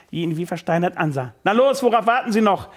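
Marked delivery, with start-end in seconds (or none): 1.4-2.7 louder, higher urging; impatient shout. Acted. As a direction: hurry her up sharply. Boss pushing assistant. (ungeduldig antreibend)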